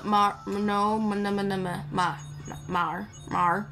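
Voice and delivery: ordinary talk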